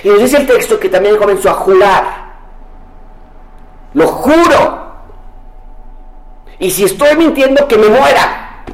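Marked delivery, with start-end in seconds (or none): 3.9-8.6 juramento. Acted. Pedro jura desesperado.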